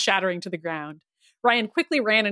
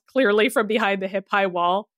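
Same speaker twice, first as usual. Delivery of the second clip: usual speaking voice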